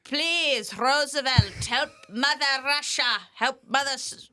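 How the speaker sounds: high, loud mock Russian accent, pleading; Stalin Russian accent. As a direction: ham up a Russian plea to Roosevelt. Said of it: "a Stalin accent pleading for the Allies to come help".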